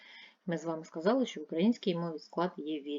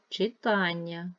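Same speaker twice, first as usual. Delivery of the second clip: slow Читання, stretched on -тан-; stress on second syllable; 4 dB louder, slower than usual. Shown as a demonstration. Teacher counting vowels for pupils, second-syllable stress on Читання. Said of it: "два, другий".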